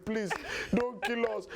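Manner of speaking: high-pitched plea; mock begging. Robbers begging the armed boy, pleading high. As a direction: beg for mercy, hammed-up.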